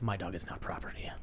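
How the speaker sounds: breathy whispered aside, no voice at all; voiceless whisper to himself; hushed whisper. Retold or imitated. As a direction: whisper it like a secret vow. Inner thought voiced in retelling.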